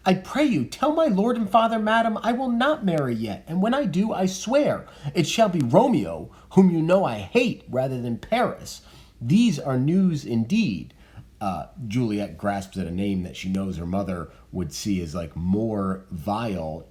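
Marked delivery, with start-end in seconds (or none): 0.1-10.9 high, loud, defiant protest; defiant refusal. Acted. Juliet tells her mother she will not marry, loud and high. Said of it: "she's about to freak out here".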